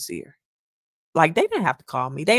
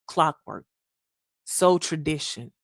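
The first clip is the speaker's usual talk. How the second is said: ordinary talk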